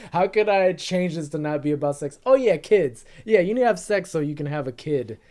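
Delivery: loud, thinking-aloud priest; scheming priest. Retold or imitated. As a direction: talk yourself into a cover story. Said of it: "High Sparrow's like".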